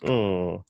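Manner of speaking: "ng" alone as a held nasal hum; isolated ng sound. Shown as a demonstration. Teacher isolating the hanging consonant, a held hum. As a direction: hum "ng" with no vowel. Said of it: "If I was to remove the H A".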